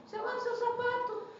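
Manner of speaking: "Você lava o seu sapato." jumps way up high, every word squeaked; the same line flipped high for a laugh; sudden high squeak. Shown as a demonstration. Repeats the shoe line, pitched up.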